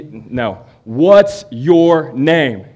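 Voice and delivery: slow, loud repeated demand; angry demand. Retold or imitated. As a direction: insist angrily, dragging each word.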